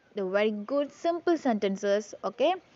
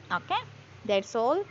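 normal talking voice

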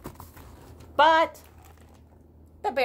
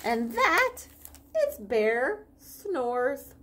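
put-on reading to children; 2 semitones higher than usual. Reading picture book aloud.

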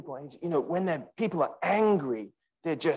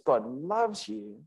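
soft assurance; 3 semitones higher, 5 dB quieter, slower than usual. Real, not acted. Tender reassurance after doubts.

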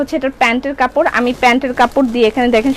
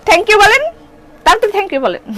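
playful demand; 5 semitones higher than usual. Real, not acted. Demands thanks for discount.

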